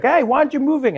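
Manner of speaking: high-pitched imitation of an annoyed cop; mock police challenge; challenging policeman. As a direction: demand it like an officer. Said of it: "the guy looked at him, he was like".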